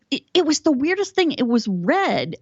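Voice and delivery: lightly voiced mom, marvelling; a hint of a bewildered mother; imitating mom. Mother describing strange bird.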